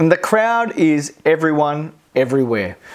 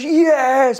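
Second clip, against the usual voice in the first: mock cheer; 10 semitones higher than usual. Crowd cheering.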